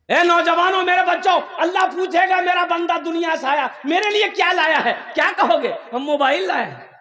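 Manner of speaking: high-pitched preacher's challenge; impassioned exhortation. Acted. Preacher asks young men what they will bring Allah, high-pitched exhortation.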